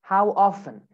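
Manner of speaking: British "often" as "off-en", silent T; British accent. Shown as a demonstration. Teacher models British "often" for students, T dropped. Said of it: "In British accent".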